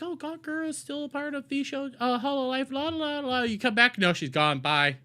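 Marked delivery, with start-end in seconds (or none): 0.0-3.4 high, soft, oblivious singsong; mock cheerful, la la la; lightly sung oblivious viewer, carefree and soft. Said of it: "going like".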